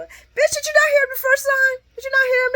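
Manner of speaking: very high, loud exasperated yell; exasperated shout. Real, not acted. Vlogger yelling at Laura on screen, shrill exasperated shout.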